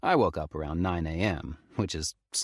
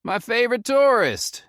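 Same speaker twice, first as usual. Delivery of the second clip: much higher, louder Southern ranger greeting; southern drawl; 8 dB louder than usual. A narrator's reading. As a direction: warm Southern drawl, teasing. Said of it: "in his thick southern drawl".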